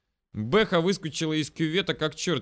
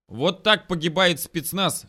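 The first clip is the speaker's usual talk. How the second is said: raised, slow mock-heroic declaration; mumbled while chewing; 5 semitones higher, 5 dB louder, slower than usual. A narrator's reading. Cornered scout chewing paper, slow heroic mumbling. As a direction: declare your doom through a mouthful. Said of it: "промямлил он".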